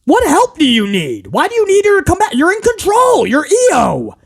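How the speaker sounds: high-pitched incredulous questioning; exasperated. Real, not acted. Scolding a wrestler in control, exasperated and high-pitched. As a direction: rant in disbelief, voice climbing high.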